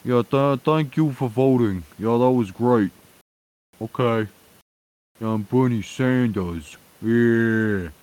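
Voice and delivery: high put-on voice, going through the motions; unenthused Bernie Sanders. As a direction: imitate a Vermont accent thanking voters without enthusiasm. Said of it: "doesn't seem very enthused about it".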